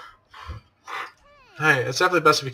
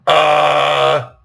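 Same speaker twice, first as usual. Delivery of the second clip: stunned uh; 4 semitones higher, 8 dB louder than usual. Acted. Stunned grunt after Byakuya claim.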